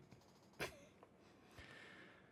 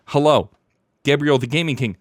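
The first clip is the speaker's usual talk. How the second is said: ordinary delivery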